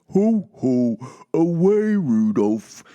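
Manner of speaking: loud, high Santa call to the reindeer; jolly Santa bellow; booming Santa. Acted. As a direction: belt it out like Santa at the reins.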